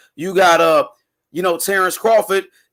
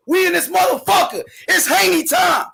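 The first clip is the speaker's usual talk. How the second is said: hype shout; 8 semitones higher than usual. Real, not acted. Caller hyping Haney time.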